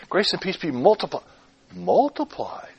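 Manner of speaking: "multiplied" set apart and savored; pause, then repeat "multiplied" with weight; leaning on "multiplied" as a word to examine; stress on MULTIPLIED. Shown as a demonstration. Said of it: "By emphasizing different words".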